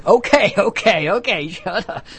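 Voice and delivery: mock-annoyed hushing with a laugh; exasperated uncle. Uncle hushing nieces and nephews, mock exasperated. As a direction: shoo the kids off, half laughing. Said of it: "And then I curse".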